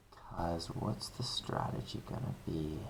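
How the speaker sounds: soft whisper, asking herself; whispered question. Real, not acted. Teacher wondering about the strategy, asking herself in a whisper. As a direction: hush it as a private wonder.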